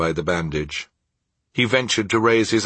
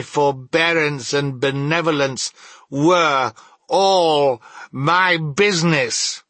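high, ringing lament; anguished ghostly cry; 8 semitones higher, 4 dB louder, slower than usual. A narrator's reading. The ghost rebukes Scrooge about its true business, crying out. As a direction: build to a wailing, impassioned declamation. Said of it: "cried the ghost, wringing its hands again".

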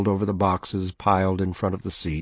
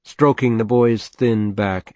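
ordinary delivery

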